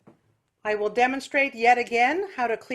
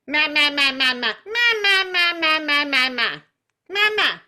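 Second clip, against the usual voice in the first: super nasal; 2 semitones higher, 5 dB louder than usual. Shown as a demonstration. Teacher shows the class a super nasal quack. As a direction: push every ma up into the nose. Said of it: "It's super nasal".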